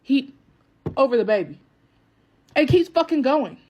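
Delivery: furious disbelief building; outraged telling. Real, not acted. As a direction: let the horror build.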